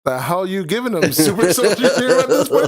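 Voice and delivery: loud, high-pitched disbelief with a laugh; incredulous outburst. Retold or imitated. Acting out own incredulous reaction.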